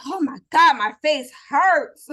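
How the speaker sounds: loud, high, slow complaint; exhausted exclaim. Retold or imitated. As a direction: groan it out loud and tired. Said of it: "I walk out like".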